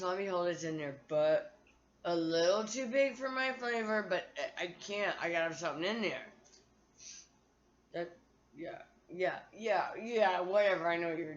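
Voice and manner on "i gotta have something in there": ordinary delivery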